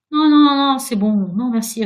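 loud, high run of noes, easing down; hasty refusal. A narrator's reading. Ghost declining Virginia's sandwich, hasty refusal. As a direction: wave the offer away.

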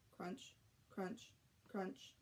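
hushed, soft sound words; whisper-soft crunch crunch. A narrator's reading. Reader softly voicing the dragons munching, hushed.

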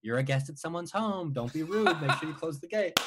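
a hint of a mother's reminder, with a laugh; retelling his mom nagging in his head, lightly acted; mom's voice. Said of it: "my mom inside my head going".

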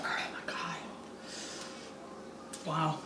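breathless whispered gasp. Real, not acted. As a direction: whisper it, overwhelmed by the heat. Reacting to burning pepper.

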